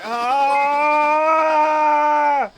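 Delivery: loud, sustained high yell; long scream. Retold or imitated. Streamer acting out reactions to asking chat for money, long scream. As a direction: scream and hold it. Imitated scream in retold exchange.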